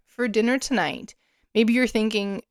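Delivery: usual speaking voice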